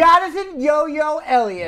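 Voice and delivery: high, slow shouted introduction; yelling. Real, not acted. Host introducing co-host to the audience, yelling. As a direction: yell the name out, stretching it. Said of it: "Why am I yelling?".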